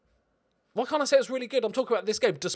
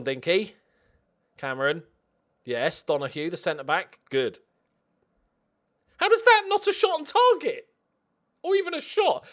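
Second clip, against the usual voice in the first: usual speaking voice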